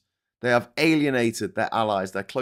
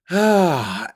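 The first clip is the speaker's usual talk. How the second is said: loud, heavy sigh; 5 semitones higher, 6 dB louder than usual. Real, not acted. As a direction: let out a big audible sigh. Sigh before reading next comment.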